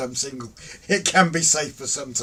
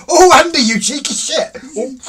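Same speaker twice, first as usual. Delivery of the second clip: very loud, high involuntary outburst; startled, high-pitched shout; shocked reaction; 11 semitones higher, 14 dB louder than usual. Real, not acted. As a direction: blurt it out at full volume. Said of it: "That was a reaction".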